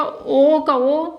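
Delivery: ओ का ओ stretched very slowly; drawn out slowly. Shown as a demonstration. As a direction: dwell on each sound for the class. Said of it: "तो क्या हो जाएगा?".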